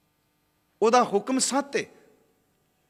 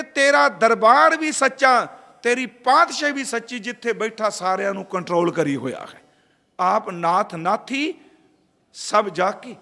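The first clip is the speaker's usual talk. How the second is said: preaching at full force; 5 dB louder than usual. Acted. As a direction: proclaim it to the congregation. Proclaims the Guru's words. (ਜ਼ੋਰਦਾਰ ਪ੍ਰਚਾਰ)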